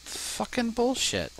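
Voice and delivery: high, slow, disgusted curse; exasperated curse. Real, not acted. Player fumes at his vanished carts, slow high curse. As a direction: let the frustration out on each word. Annoyed at lost iron.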